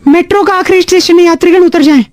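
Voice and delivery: formal passenger announcement; metro announcer. Pretend. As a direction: announce the last stop to passengers. Co-host plays the metro announcement again.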